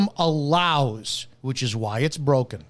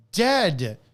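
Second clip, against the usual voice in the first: ordinary talk, nothing marked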